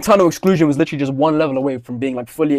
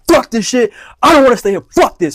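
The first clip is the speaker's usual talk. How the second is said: high, loud, furious re-enactment; retold yelling; 9 semitones higher, 6 dB louder than usual. Pupil refusing to stay after school, re-enacted angry yell. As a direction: burst out with each curse punched hard. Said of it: "I was yelling".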